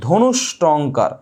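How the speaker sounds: ধনুষ্টঙ্কার drawn out very slowly, syllable by syllable; slow and deliberate. Shown as a demonstration. Teacher repeats the exam word for students, very slowly. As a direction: spell ধনুষ্টঙ্কার out slowly with your voice so it sticks. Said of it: "কি বলেছি?".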